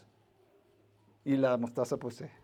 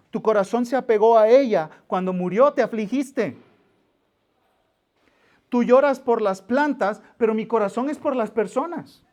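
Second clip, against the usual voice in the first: higher, louder, pleading sermon delivery; impassioned preaching; 7 semitones higher, 8 dB louder than usual. Acted. Preacher voicing God to Jonah, impassioned and pleading. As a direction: preach God's grief with fervor.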